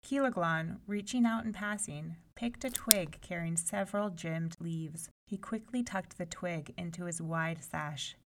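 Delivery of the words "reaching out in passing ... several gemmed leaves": ordinary delivery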